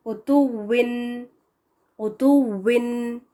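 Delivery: "उतुविन" slow, rounded W at the end; correct final waw. Shown as a demonstration. Teacher showing correct "उतुविन", slowly. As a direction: stretch out "उतुविन" with clear W. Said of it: "आखिर में जब आता है वाव".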